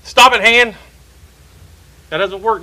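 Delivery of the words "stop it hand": loud, higher command to stop; the whole body yells at the hand now; louder scolding. Retold or imitated. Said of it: "Then you bring the hand in the foot".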